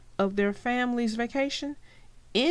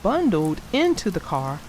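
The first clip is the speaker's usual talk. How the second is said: lively read-aloud storyteller voice; story narration; 4 dB louder than usual. A narrator's reading. Narrator reading the Bear family story aloud to kids. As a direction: tell it like a bedtime picture book.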